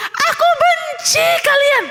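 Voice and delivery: very high-pitched yell; angry shout. A narrator's reading. Theo yelling into the valley, angry shout. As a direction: shout it out like an upset child. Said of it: "Karena waktu dia bilang".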